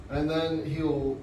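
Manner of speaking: ordinary talk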